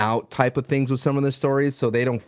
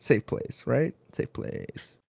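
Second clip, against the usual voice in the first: whisper; 7 dB quieter, much slower than usual. Pretend. Whispering the podcast catchphrase.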